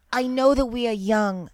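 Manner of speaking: dramatic lyric read in a hammed-up Jersey voice; mock Jersey accent, dramatic. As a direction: read the lyrics like a Jersey girl, over the top. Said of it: "if you're in a Jersey voice".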